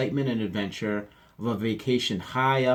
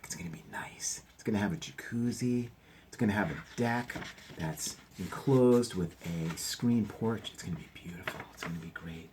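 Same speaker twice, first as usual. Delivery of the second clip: whisper; 4 dB quieter than usual. Real, not acted. Whispered guesses about cabin.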